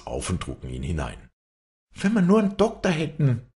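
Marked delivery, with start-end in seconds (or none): 1.9-3.5 high, loud lament; wailing women. A narrator's reading. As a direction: wail helplessly, pitched way up. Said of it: "jammerten die Weiber".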